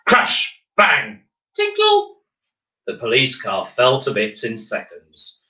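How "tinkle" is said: very high tinkle like breaking glass; ping it out high and light, a glass chime; tinkly sound effect. Acted. Narrator voicing the car falling apart with a high tinkle.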